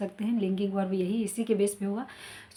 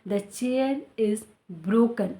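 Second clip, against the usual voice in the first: very slow, careful sentence; careful demo; slow model sentence; much slower than usual. Shown as a demonstration. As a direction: teach it slowly. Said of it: "teacher models example sentence".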